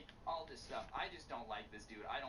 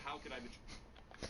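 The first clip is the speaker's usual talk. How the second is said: mocking misquote; 2 semitones higher, 5 dB quieter, slower than usual. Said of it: "finally the one that says".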